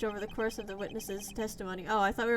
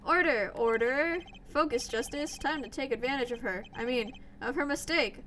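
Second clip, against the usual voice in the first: normal talking voice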